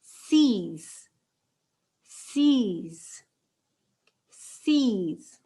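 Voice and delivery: six said as sees, firm and clear; model pronunciation. Shown as a demonstration. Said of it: "On dit".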